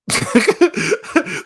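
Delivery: loud, high cackling laugh; high-pitched burst of laughter. Real, not acted. Reader seeing scary eyes, bursting out laughing.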